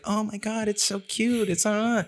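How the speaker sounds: high gushing coo; mock cooing at a piglet; gushing over cute. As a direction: coo over it like a baby animal.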